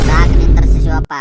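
roaring stop; furious shout. Acted. Landlord roaring at drunk Shivappa to stop, furious shout. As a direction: bellow it at full force to shut him up.

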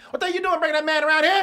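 loud, high, fast furious scolding; angry father yelling. Retold or imitated. Father confronting son about his friend, furious yell. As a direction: yell it like a dad losing his temper. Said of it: "that's the way he was talking to me".

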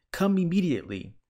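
imperious emergency summons; urgent command. A narrator's reading. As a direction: order them over at once. Urgent command to fire department.

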